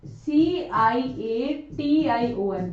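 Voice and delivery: letters spelled one by one, very slowly; slow spelling. Shown as a demonstration. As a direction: dictate each letter of the spelling deliberately. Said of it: "देखो दोबारा लिखती हूँ". (अक्षर-अक्षर धीरे बोलकर spelling)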